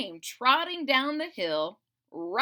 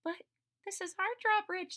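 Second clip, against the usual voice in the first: protesting villagers; 10 semitones higher than usual. Acted. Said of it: "they cried".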